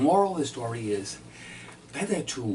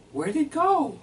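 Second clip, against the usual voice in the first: high, loud sarcastic wife voice; mock the wife's scolding, pitched up; mimicking his wife; 6 dB louder, slower than usual. Husband voices his exasperated wife at the table, high and loud. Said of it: "And she says".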